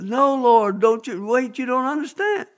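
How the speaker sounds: high-pitched, urgent plea; desperate pleading. Retold or imitated. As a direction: beg the Lord to hear you out. Preacher pleads with God.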